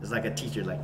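ordinary talk, nothing marked

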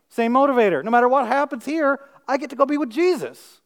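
high, forceful sermon delivery; exultant preacher in full flow; impassioned preaching. Acted. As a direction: preach it with rising fervour, pitched well up.

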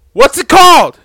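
high, loud burst of searching; exasperated blurt. Real, not acted. Host groping for the word pancakes, exasperated high blurt.

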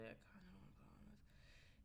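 whispered aside. Real, not acted. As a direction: murmur it to yourself.

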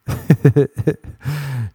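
short burst of real laughter. Real, not acted. Laughing at his own podcast joke, a real laugh.

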